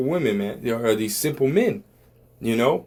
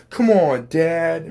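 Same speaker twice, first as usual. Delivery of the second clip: mock whiny son, higher and louder; 6 semitones higher, 4 dB louder, much slower than usual. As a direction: imitate a son pleading with his dad. Acted movie son protest.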